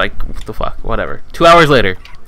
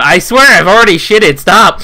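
high, loud mock pleading; acted protest; 6 semitones higher, 5 dB louder than usual. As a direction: protest as if being pestered. Acted protest at being wiped.

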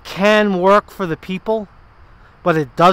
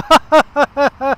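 imitating a mocking laugh; 7 semitones higher, 4 dB louder than usual. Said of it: "You heard him go".

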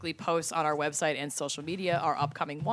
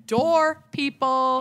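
excited; 8 semitones higher, 6 dB louder, much slower than usual. Acted. Excited ad read emphasis.

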